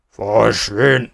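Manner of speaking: loud villain's yell; angry scream. A narrator's reading. Schnickels bitten in the ankle by Jack, angry scream. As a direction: yell it to drive them off. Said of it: "skreg han".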